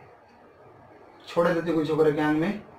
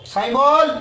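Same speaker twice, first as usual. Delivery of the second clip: sharp higher, louder command; loud demand; 6 semitones higher, 6 dB louder, slower than usual. Real, not acted. Exorcist ordering the spirit, loud. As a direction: bark the order.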